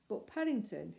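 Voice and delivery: ordinary delivery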